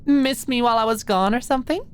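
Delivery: put-on high, sweet teasing girl voice; Maud's high girly voice.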